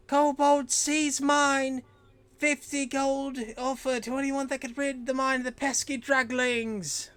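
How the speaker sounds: high, loud town crier shout; kid yelling out. Acted. Kid on a box announcing a bounty, yelling out. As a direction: holler the news over a crowd. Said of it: "kinda yelling out".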